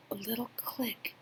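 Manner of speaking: breathy hushed narration; whisper. A narrator's reading. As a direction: whisper it like a tiny click. Tiny click described.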